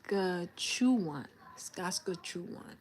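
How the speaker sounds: "Saskatchewan" slowly as "Saska-chu-wan"; careful practice. Real, not acted. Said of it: "How I pronounce that?".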